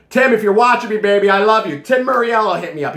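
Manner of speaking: high, loud, hammed-up declaration of love; loud shout-out. Reviewer thanking his supplier on camera, loud playful shout-out. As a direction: call out to a friend through the camera.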